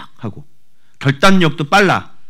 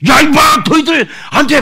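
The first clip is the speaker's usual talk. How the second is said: much higher, very loud scolding; mock scolding yell; 14 semitones higher, 9 dB louder than usual. A parent yelling at children, loud mock scolding. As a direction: snap at the kids loudly. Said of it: "막 이렇게 하지 마시고".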